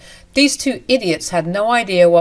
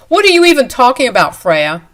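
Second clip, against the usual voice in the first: loud, fast, higher angry retort; angry snap; 5 semitones higher, 7 dB louder than usual. Acted. Husband snapping at his wife, fast angry retort. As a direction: fire it back fast and loud.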